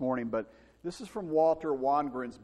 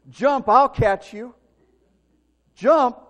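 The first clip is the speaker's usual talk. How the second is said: slow, much louder and higher shout; shouted encouragement; 9 semitones higher, 13 dB louder, slower than usual. Retold or imitated. Father shouting up to his son, slow loud encouragement. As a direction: urge him to jump, loud.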